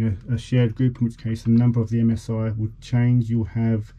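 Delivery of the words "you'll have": usual speaking voice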